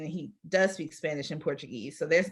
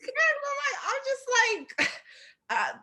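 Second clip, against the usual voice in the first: much higher, slowed by laughter; agreeing with a friend while laughing hard; slower than usual. Real, not acted. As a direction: get it out through helpless laughter.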